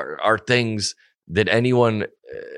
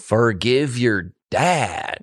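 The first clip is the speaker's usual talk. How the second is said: slow, drawn-out mock counsel; slow mocking advice; much slower than usual. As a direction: stretch it out, teasing.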